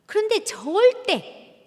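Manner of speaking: 절대 drawn out, high and loud; stress on 절대. Shown as a demonstration. Lecturer stressing 절대 to the mothers, high, loud and slow. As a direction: lean hard on 절대, slowly. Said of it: "teacher stressing a point".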